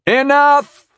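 high, loud commanding cry; loud interruption. A narrator's reading. Narrator cuts off the knight's rant, loudly. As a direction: cut in with a forceful shout. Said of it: "I said loudly".

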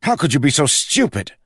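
high, loud angry snap; loud furious snap; snapping. Acted. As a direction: lash out at her. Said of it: "he snapped".

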